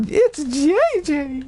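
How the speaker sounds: high, drawn-out mimicked squeal of a greeting; excited greeting. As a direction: stretch it out high, imitating a delighted friend. Retold friend's drawn-out greeting.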